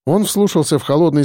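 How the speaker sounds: plain reading voice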